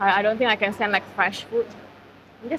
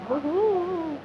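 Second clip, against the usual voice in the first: excited; 8 semitones higher than usual. Real, not acted. Showing off thick gravy.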